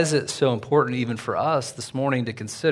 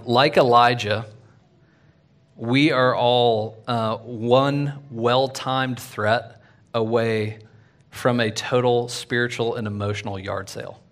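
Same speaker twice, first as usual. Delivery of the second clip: each phrase stretched out very slowly; slow it right down and let it land; a preacher stating his thesis, very slow; slow deliberate statement; slower than usual. Real, not acted. Slowed for key statement.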